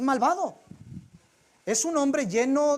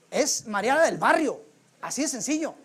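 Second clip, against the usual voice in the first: usual speaking voice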